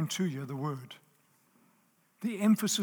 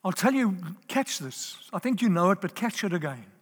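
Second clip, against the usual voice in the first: normal talking voice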